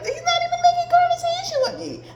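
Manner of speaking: high, fast whine with a laugh; high laughing complaint; high whiny complaint. Retold or imitated. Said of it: "I'm like".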